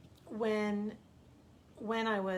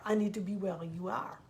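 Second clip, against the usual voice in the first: French accent impression. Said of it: "he'd say".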